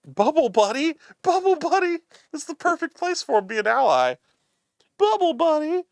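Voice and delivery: very high, loud cartoon squeal; excited cartoon voice. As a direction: cry it out in a high-pitched cartoon voice. Acting out a cartoon catchphrase.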